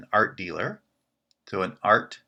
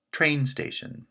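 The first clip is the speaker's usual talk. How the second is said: Train station with the pitch on Train; stress on train; 7 semitones higher than usual. Shown as a demonstration. Teacher demonstrating compound stress on Train. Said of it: "The pitch is on the first word".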